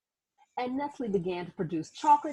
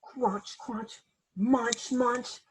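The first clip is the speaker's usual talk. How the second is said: crunching words; much slower than usual. Acted. Eating sound words.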